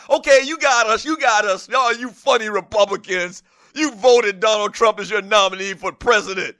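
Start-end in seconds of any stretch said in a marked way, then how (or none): none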